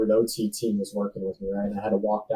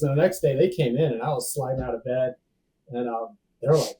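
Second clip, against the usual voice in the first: ordinary talk, nothing marked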